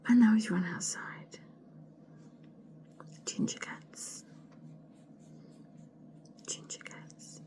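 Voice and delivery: exasperated hushed whisper; muttered whisper about the cat. Real, not acted. Whispering, cat just misbehaved.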